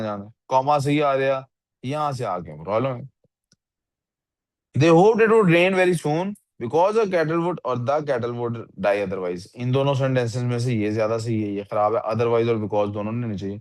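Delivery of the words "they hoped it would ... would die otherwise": slow, careful reading of the corrected sentence; slow trial reading. Shown as a demonstration. Teacher checking the answer for the student, slowly. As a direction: read it through slowly to check it. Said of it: "मैं इन sentences को ऐसे पढ़ता हूँ".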